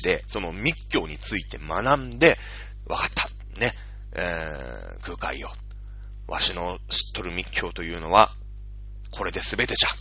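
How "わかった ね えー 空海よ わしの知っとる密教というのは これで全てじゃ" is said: lightly voiced old master, soft and low; a hint of a dying old sage; creaky wise old man. Dying master speaks to Kukai.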